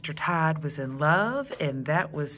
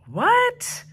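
much higher, louder, puzzled; loud what; 7 dB louder than usual. Acted. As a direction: shout back through the door. Stink can't hear.

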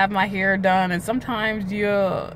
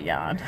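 long low yawn mid-sentence; a real tired yawn slipping out; 7 dB quieter than usual. Real, not acted. Apologizing to viewers for a low yawn. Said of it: "Sorry about that".

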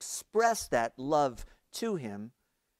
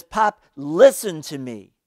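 louder, firm plea to his father; firmer and louder; 3 semitones higher, 12 dB louder than usual. A narrator's reading. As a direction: press it harder and louder. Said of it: "with a firmer and louder voice".

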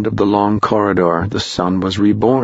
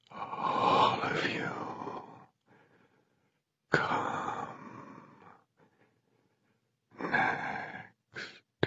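slow, airless whisper from below; ghostly whisper; 19 dB quieter, much slower than usual. A narrator's reading. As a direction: breathe it like wind from a tomb. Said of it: "Whispered the wind".

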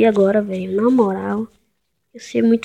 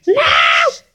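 shout; 12 semitones higher, 4 dB louder than usual. Real, not acted. Dying in the game.

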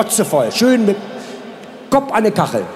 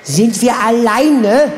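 langsame Frage; 3 semitones higher, much slower than usual. Acted. Frau auf Klo fragt Freundin.

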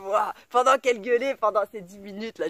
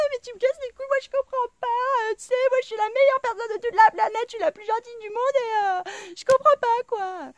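high, louder whining caricature; mock whiny victim; 12 semitones higher, 5 dB louder than usual. Mocking annoying people whining that they're victims. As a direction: play the crybaby who thinks she's perfect. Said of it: "ils sont là en train de pleurer".

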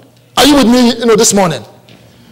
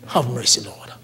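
low, much softer plea; hushed plea for mercy; 10 semitones lower, 12 dB quieter than usual. Acted. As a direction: beg for mercy under the breath, head bowed. Pleading again.

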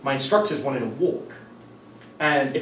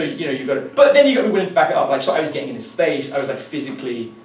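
ordinary talk

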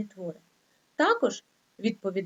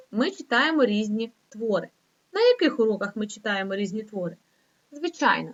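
normal talking voice

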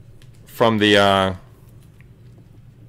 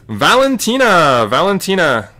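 excited reveal; 8 semitones higher, 7 dB louder than usual. Real, not acted. Breaker reveals who the flyweight card is, excitedly. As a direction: call the name out high and loud.

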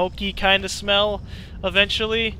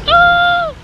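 very high, loud "oh"; hammed-up high yelp, held long; imitated cry of alarm; 11 dB louder than usual. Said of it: "I was like".

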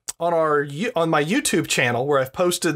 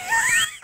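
high burst of laughter. Real, not acted. Laughing at parents' bandwidth.

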